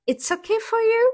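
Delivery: high, loud, hammed-up English accent; exaggerated English accent. Streamer jokingly checks with the teasing viewer, exaggerated English accent. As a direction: play up the English accent, pitched right up. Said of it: "de mon accent anglais".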